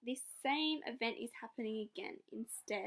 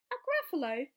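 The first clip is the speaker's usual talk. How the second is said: mock amazed mouse; 5 semitones higher, 7 dB louder than usual. Mouse mocks the fox.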